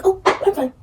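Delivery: high, bright fake-fine chirp; fake cheerful "I'm fine". Girls pretending all is well, forced high "I'm fine". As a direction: brush it off with a forced high smile. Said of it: "they be like".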